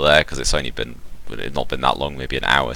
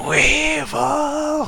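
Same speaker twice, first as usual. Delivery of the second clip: high, stretched mock battle cry; long drawn-out roar. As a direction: draw the name out in a high, restrained roar. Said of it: "long, drawn out roar".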